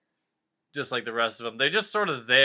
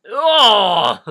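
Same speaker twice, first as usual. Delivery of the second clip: loud startled oh, higher and louder; sudden oh; 7 semitones higher, 14 dB louder than usual. Real, not acted. Catching his own story's gross detail, a startled oh. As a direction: blurt out a startled oh. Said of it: "laughs, realizes the water".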